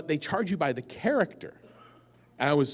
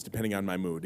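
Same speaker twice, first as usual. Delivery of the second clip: usual speaking voice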